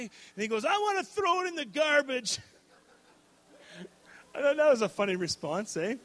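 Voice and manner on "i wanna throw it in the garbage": put-on 1 upset kid. Crashed son still upset.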